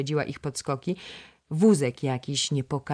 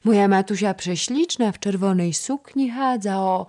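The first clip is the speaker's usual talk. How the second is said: put-on much higher, louder child's voice; chattering toddler; 6 semitones higher, 6 dB louder than usual. A narrator's reading. Toddler telling the princess about her play, high. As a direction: babble it like a little girl.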